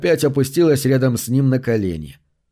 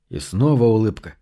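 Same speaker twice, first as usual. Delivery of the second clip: plain reading voice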